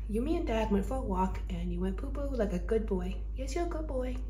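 soft praise. Real, not acted. Owner softly praising her dog after a walk.

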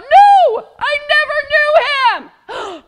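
very high, very loud furious denial; angry shout. Acted. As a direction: yell it out in anger, slowly. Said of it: "Peter, he got so angry".

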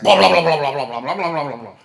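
loud burst of blah, fading off; a sudden loud torrent of blahs that tails away; loud rapid blah. Retold or imitated. The Lord, as the preacher tells it, pouring out talk loudly, then fading. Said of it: "And he's like".

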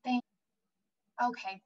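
usual speaking voice

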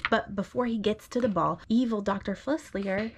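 gentle storybook read-aloud; reading to a child. Acted. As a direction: read it like a bedtime story to your little one. Parent reads picture book aloud.

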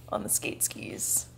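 soft whispered aside; whispering. Real, not acted. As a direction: whisper the words.

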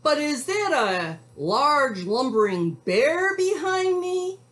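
high, loud storybook alarm rising at the end; scared cowboy. A narrator's reading. Frightened cowboy suspects a bear behind him, high alarm.

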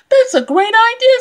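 put-on high, fast, eager girl; chirpy child jumping at the idea; excited little girl.

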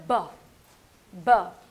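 ब as a short, low, punched "buh"; letter sound. Shown as a demonstration. Teacher demonstrating the B sound, punched letter sound. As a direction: burst ब out loudly, falling in pitch. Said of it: "B की sound क्या होती है?".

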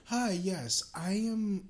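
ordinary delivery

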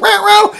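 loud, high meow; cat yowl. Acted. Storyteller voices a cat fleeing the dog, loud high meow.

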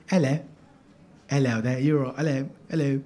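low-pitched British accent demo. Shown as a demonstration. Comic shows off the accent he loves, low British accent. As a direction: put on a low British "hello". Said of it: "I love the British accent though".

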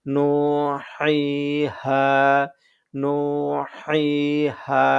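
নুহাইহা very slow, each vowel held on a level note; drawn-out vowels. Shown as a demonstration. Teacher giving madd example নুহাইহা, long steady vowels. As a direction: stretch every syllable of নুহাইহা evenly. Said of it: "এক আলিফ টেনে পড়তে হবে".

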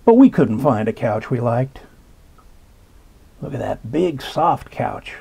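usual speaking voice

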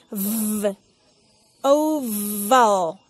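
V buzzed with teeth on the lip, then oval; strong English V. Shown as a demonstration. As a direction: hit the V in oval hard. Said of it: "poner los dientes encima de los labios para que la V suene fuerte".

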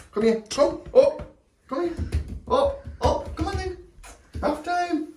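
very high pet-coaxing voice; short, high calls to coax her up; coaxing the dog. Real, not acted. Dad urging the curled-up dog into the bath, high coaxing.

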